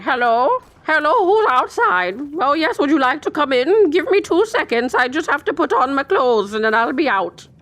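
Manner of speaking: high, sing-song voice calling through the door; fake friendly host. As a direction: pretend to invite the monsters in sweetly. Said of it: "distracted them by being inside and be like".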